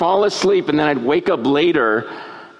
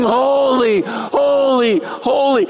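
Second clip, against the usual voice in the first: high, drawn-out angelic cry; mock screaming; 9 semitones higher, slower than usual. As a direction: call out each holy high and long. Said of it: "they're screaming".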